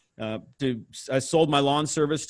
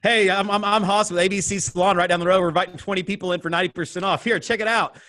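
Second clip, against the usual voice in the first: door-to-door sales pitch; 5 semitones higher than usual. Retold or imitated. Young salesman pitching spa coupons at every door, fast and bright. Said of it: "I did that pitch".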